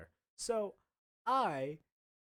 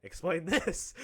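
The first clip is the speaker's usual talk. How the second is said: louder, higher mock-stern order; dean demanding answers; 4 semitones higher, 5 dB louder, slower than usual. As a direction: confront the student with evidence. Dean confronts him with laptop.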